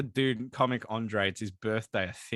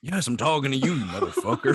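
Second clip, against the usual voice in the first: gruff outlaw; 2 semitones lower, 4 dB louder than usual. Acted. In-character retort to Dutch.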